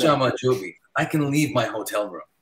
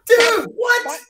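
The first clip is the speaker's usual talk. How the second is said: very high, loud shout of disbelief; high-pitched incredulous cry; amazed outburst; 10 dB louder, much slower than usual. Real, not acted. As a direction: yell it in real astonishment. Amazed reaction to the story.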